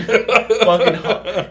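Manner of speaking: breaking into loud laughter, high; words lost in a real laugh. Real, not acted. Storyteller cracking up retelling the story, laughing hard.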